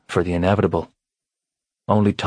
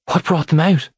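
high, louder alarmed question; frightened mother; 10 semitones higher, 4 dB louder than usual. A narrator's reading. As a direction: ask in alarm, a scared mother.

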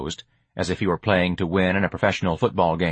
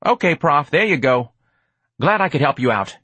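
put-on higher, louder street-kid voice; breezy, pitched-up send-off; streetwise hustler, chipper; 7 semitones higher, 4 dB louder than usual. George finishes helping the Prof, chipper.

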